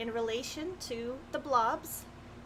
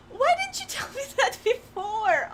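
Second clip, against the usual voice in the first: surprised gasp; 12 semitones higher, 6 dB louder than usual. Real, not acted. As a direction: gasp then laughing disbelief. Learns she won't lose souls.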